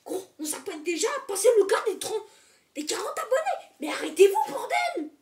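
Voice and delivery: excited, high, loud rant; hammed-up shouting at subscribers; mock outrage. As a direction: build to a joking exasperated yell. Non, je rigole.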